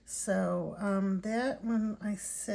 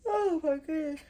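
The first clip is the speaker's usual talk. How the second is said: words stretched through a big yawn; real tired yawn mid-sentence; 6 semitones higher, 5 dB louder than usual. Real, not acted. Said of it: "I'm sorry, I'm yawning".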